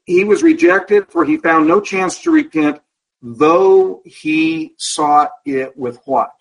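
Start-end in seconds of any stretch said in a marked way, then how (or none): 3.2-6.3 drawn out, leaving the last word open; slow the line down so the class fills in the word; slow teacher's prompt, waiting for an answer; slow prompting read. Shown as a demonstration.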